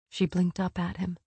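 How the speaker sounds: usual speaking voice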